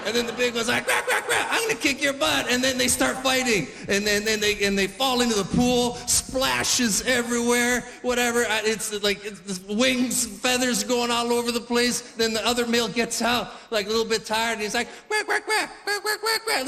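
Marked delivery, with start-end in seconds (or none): 0.9-2.5 very high, squawky quacks into a threat; hammed-up furious duck; big duck threat. 15.1-16.7 very high, winded quacking; hammed-up duck; tired duck quacks.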